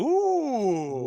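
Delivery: long, loud, high-pitched ooh; impressed reaction. Real, not acted. Game master reacting to a player's great roll, impressed. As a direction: draw out a big, high "ooh" at the lucky roll.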